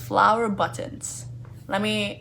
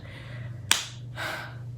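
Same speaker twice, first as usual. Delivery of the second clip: long exasperated sigh; 8 dB quieter than usual. Real, not acted. Exasperated at the dress.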